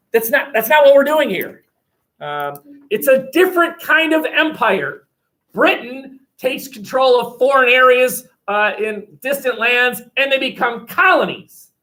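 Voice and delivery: emphatic lecturing. Real, not acted. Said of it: "teacher stresses contrast".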